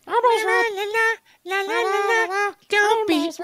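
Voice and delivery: very high sing-song puppet voice; Elmo voice. Pretend. Cohost imagining his penis as Elmo, very high sing-song. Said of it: "lean toward Elmo".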